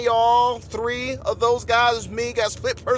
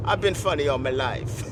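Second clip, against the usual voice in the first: low, understated retort; lightly imitated low voice; 7 semitones lower than usual. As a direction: drop the pitch as in the singer's line. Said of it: "I'm born from [name]".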